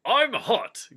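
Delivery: high, loud sudden squeak; Gandalf's silly announcement. Acted. Gandalf announces to Frodo he's hot, high squeak.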